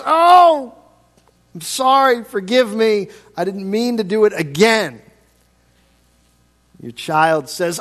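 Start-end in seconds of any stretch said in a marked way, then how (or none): 0.0-5.0 high, louder whiny apology; pleading friend. Retold or imitated. Preacher imitating a friend repeating a sorry apology, whiny. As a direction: play the friend begging forgiveness a third time.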